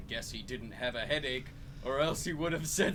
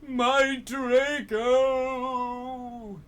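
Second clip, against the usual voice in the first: moaning; 10 semitones higher, 7 dB louder, much slower than usual. Acted. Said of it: "he moaned".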